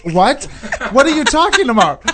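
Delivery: high, laughing disbelief; retold incredulity. Reacting to co-host's dream announcement, incredulous. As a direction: rise into a baffled, amused protest.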